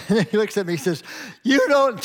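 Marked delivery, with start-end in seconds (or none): 1.4-2.0 high, loud cry as tears well up; a grown son breaking, tears in his eyes; tearful outburst. Retold or imitated. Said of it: "tear in his eyes".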